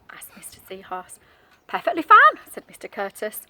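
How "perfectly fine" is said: cheerful fish. Acted. Fish answers cheerfully in story.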